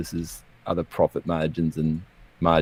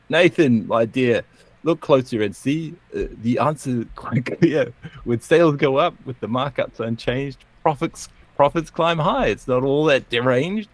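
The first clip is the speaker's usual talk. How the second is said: raised, lilting rebuttal in verse; poem recital; 6 semitones higher than usual. Acted. Economist interjects to correct Nathan, recited theatrically. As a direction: lift the pitch and argue it in rhyme.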